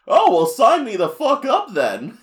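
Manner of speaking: high, loud, slow cartoon voice; hammed-up eager character impression; Chef Kawasaki. The chef hearing the king hates Kirby, loud high impression. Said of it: "Kawasaki hears that and is like".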